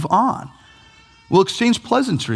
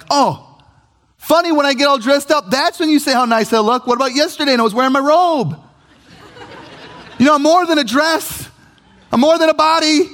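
much higher, louder, pointed retort; sarcastic spouse; 11 semitones higher, 3 dB louder than usual. Retold or imitated. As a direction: play the miffed spouse calling him out. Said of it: "They're like".

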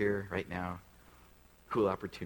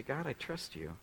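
quiet modelled prayer; 3 semitones lower, 7 dB quieter than usual. Shown as a demonstration. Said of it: "You say".

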